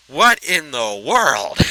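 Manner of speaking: surprised. Real, not acted. Sudden surprise at crash.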